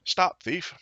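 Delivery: sharp high call of an angry gardener; high-pitched mock shout of stop thief. A narrator's reading. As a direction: cry it out after the fleeing rabbit. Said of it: "calling out".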